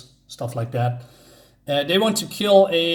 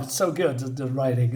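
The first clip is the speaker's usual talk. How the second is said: ordinary talk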